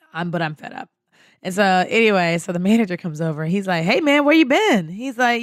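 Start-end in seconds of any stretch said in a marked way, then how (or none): 3.8-4.9 higher, louder ribbing voice; imitated manager's loud challenge; teasing callout. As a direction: call him out like a buddy. Manager ribbing the late date.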